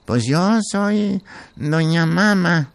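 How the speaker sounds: put-on high, slow little old lady. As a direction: creak it out like a grandma. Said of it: "así como hablaba de viejita".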